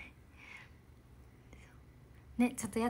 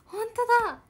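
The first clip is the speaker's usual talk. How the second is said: surprised; 11 semitones higher, 7 dB louder, faster than usual. Real, not acted. Amazed at moon in eyes.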